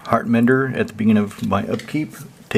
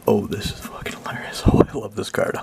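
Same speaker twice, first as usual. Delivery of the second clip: hushed whisper of delight; whispered aside. Real, not acted. Player spotting a favourite card, whispering with delight. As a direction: whisper it like a secret joke.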